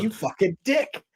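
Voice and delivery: high, drawn-out mock insult; mock resentment. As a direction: play the outbid contestant cursing, hammed up. Imagined rival bidder cursing the outbidder.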